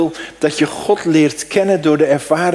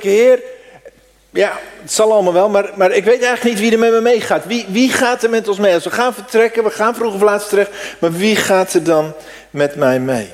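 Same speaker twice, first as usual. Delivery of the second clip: raised, urgent plea to the Lord; a fretful Moses, speaking fast and louder; pleading Moses; 7 semitones higher, 4 dB louder than usual. Acted. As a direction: press God anxiously, quick and raised. Preacher acts out Moses' anxious plea.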